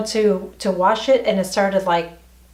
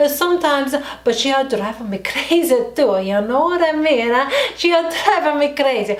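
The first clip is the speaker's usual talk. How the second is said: higher-pitched, hammed-up Italian accent; 5 semitones higher than usual. Shown as a demonstration. Vlogger griping about her best friend, mock Italian accent. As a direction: play up a sing-song Italian accent. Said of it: "I can do an Italian accent".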